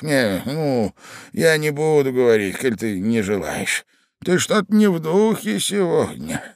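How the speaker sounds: put-on high, lilting old voice; placating old man. A narrator's reading. Old husband yielding to his moody wife, high and lilting.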